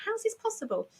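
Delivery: high-pitched incredulous question; retold astonishment in a high voice; acted disbelief. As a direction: relive your bafflement, pitch jumping up. Retold disbelief at own situation.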